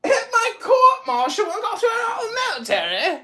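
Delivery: mocking disbelief; incredulous echo. Real, not acted. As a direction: throw his words back in disbelief. Incredulous repeat of accusation.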